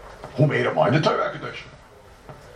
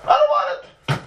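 acted refusal; 11 semitones higher than usual. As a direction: act out a guy turning down a gift, voice jumping up. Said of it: "Says the guy to the Eibishter".

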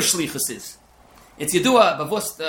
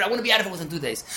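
rushed complaint; 3 semitones higher, much faster than usual. Retold or imitated. Voicing a frustrated person.

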